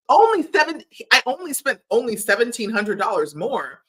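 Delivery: indignant mock repeat of the boyfriend's line; outraged mocking repeat. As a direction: throw his words back at him, incredulous. Repeats his line in outraged disbelief.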